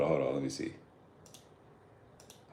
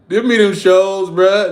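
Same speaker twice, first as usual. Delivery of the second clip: excited; 9 semitones higher, 10 dB louder, slower than usual. Real, not acted. Excited about old shows.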